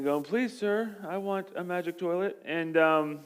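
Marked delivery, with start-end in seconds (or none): 0.2-2.4 lightly voiced begging orphan, soft; a hint of a timid orphan asking for more; pleading orphan. Orphan begging the rich man for a magic toilet, mild plea. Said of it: "like we imagine the orphans and Oliver Twist".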